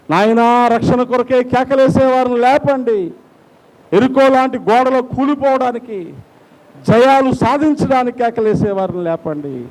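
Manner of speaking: high, impassioned pleading prayer; fervent prayer. Real, not acted. Preacher begs God for revival in churches, fervent prayer.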